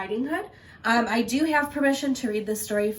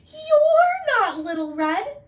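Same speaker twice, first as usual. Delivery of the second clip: very high, loud, slow shout; scared old lady realizing; alarmed granny; 7 dB louder, slower than usual. Acted. Granny yelling at wolf.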